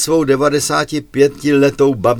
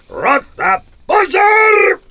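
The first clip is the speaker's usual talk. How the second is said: commanding officer's shout; much slower than usual. Acted. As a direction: bellow it across the parade ground. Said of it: "Velící důstojník před nastoupenou jednotkou".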